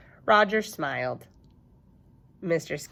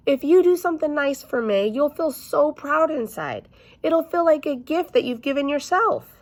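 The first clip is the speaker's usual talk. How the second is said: higher, louder sugary teacher voice; sweet coaxing teacher; 10 semitones higher, 5 dB louder than usual. Acted. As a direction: sell the kindness like a kindergarten teacher. Teacher persuading child kindly.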